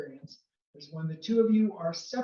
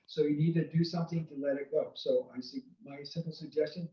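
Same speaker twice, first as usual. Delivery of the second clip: ordinary talk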